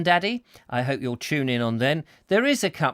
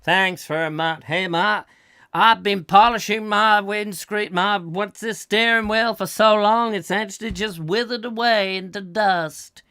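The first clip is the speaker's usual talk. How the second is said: high, raised hammed-up sketch voice; skit dad; 6 semitones higher, 4 dB louder than usual. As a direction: play a corny dad, pitched up. Acts a part in a radio skit.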